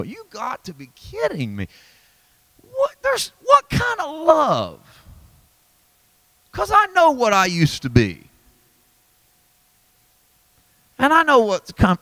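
high, rising cry of disbelief; astonished John. Retold or imitated. John in wonder writing of God's love, crying out high. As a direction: exclaim in stunned wonder. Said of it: "I hear him go".

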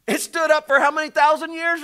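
high, loud fervent preaching. Acted. Preacher urging the congregation, rising fervor. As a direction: lift the room with urgency.

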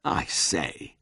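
soft, downcast murmur; quiet and deflated; despondent murmur. A narrator's reading. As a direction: murmur it, crestfallen. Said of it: "he murmured despondently".

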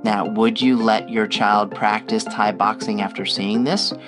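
ordinary talk

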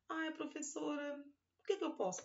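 lightly voiced child asking. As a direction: play an eager little pupil. Teacher voices imagined child.

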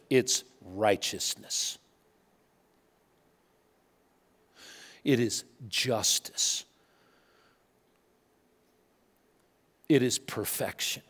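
low, soft, slow weighted words; slow solemn emphasis. Real, not acted. Preacher driving home the core motivation of a reformer, slow and grave. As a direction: lay each word down gravely.

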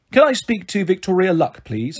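ordinary delivery

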